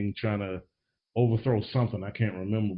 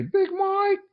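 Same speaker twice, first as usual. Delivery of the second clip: high, put-on Southern mom voice calling out; Sandra Bullock impression. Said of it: "if I heard her say".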